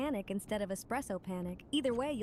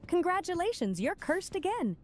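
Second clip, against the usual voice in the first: sarcastic; 8 semitones higher, 3 dB louder than usual. Real, not acted. Mock congrats after DT jinxes.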